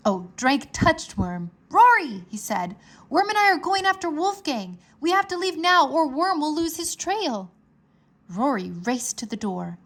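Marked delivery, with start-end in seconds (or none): 1.7-7.5 higher, louder and rushed; urgent boy in a hurry. A narrator's reading. Drake hurries to leave.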